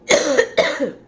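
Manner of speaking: loud, hard cough; real, forceful coughing burst; real coughing fit. Real, not acted. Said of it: "all this coughing".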